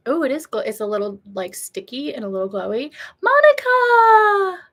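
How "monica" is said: high, loud, drawn-out cry of a name; excited greeting. Real, not acted. Streamer greeting a viewer who joins, thrilled and loud. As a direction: greet her like a long-lost friend.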